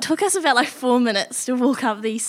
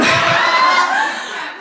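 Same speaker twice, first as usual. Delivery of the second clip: sudden squealing burst of laughter, much higher; high shrieking laugh. Real, not acted. Bursts out laughing mid-story.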